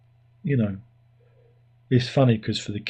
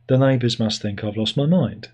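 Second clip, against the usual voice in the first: ordinary talk, nothing marked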